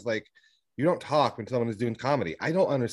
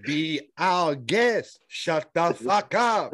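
slow, higher mock courtesy; mock polite retort; 7 semitones higher, 3 dB louder, much slower than usual. Telling off a loud bar patron, in slow mock politeness. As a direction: say it sweetly and slowly, then snap. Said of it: "I was like".

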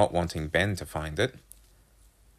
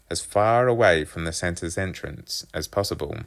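usual speaking voice

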